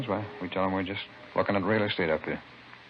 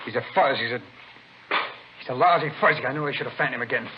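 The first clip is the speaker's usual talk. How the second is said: louder, higher, bitter outburst; angry outburst; 3 semitones higher, 3 dB louder than usual. Acted. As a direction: spit it out, furious at the cop. Gangster realizes buyer is cop.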